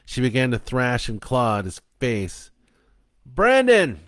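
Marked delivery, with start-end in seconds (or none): 3.2-4.0 high, loud holler of a name. A narrator's reading. Joe hollers to the hiding boy, hollering. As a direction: holler to break through a nightmare. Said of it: "he hollered".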